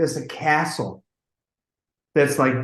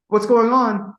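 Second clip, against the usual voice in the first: raised, louder imitation of a singer; mock calling out; 8 semitones higher, 5 dB louder than usual. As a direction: call out to the control room. [name] calls from the booth.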